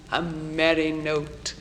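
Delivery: usual speaking voice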